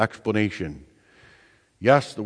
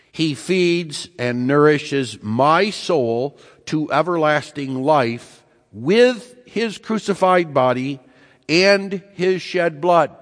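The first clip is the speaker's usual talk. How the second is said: slow, raised reading of the catechism; solemn quotation; 4 semitones higher, slower than usual. Acted. Preacher reads the catechism to his congregation, slow and raised. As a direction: lift the words up slowly, like scripture.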